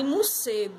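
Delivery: morcego as colloquial "mussego"; everyday "mu-SE-go" for morcego; home pronunciation. Shown as a demonstration. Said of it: "aprendi com meus pais chamar de".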